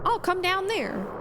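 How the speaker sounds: a restrained, high call out; shouted. Acted.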